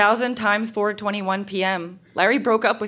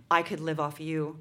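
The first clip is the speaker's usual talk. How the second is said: put-on boyfriend's line, lower and a little softer; lightly voiced boyfriend; 8 semitones lower, 10 dB quieter than usual. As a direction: drop lightly into a teenage boyfriend. Reads boyfriend's line to her.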